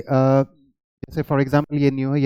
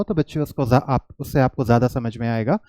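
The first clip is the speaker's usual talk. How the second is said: ordinary delivery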